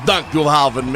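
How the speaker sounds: loud gruff-toned reggae star imitation; booming mock reggae greeting. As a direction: answer in a big put-on Jamaican singer voice. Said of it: "you're going full Shaggy mode".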